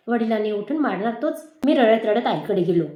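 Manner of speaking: ordinary delivery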